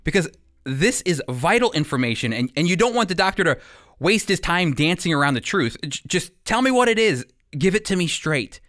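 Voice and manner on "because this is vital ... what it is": usual speaking voice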